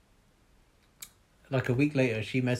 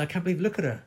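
higher and brighter, rising with excitement; excited disbelief; 5 semitones higher than usual. Retold or imitated. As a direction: show the photo, thrilled. Retelling his own disbelief to a friend.

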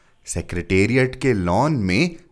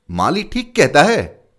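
eager agreement; 7 semitones higher, 7 dB louder than usual. A narrator's reading. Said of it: "बहुत से clerk एक साथ बोल पड़े".

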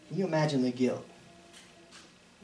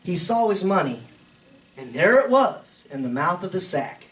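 scripture reading; 9 semitones higher, 4 dB louder than usual. Acted. Reads scripture aloud in sermon.

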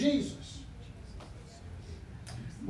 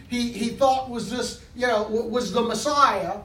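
fired-up preaching; 4 semitones higher than usual. Acted. Preaching builds up to full force.

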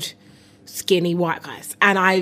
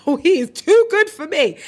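swooning exclaim; 9 semitones higher, 4 dB louder than usual. Retold or imitated. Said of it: "I'm like".

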